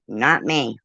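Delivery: normal talking voice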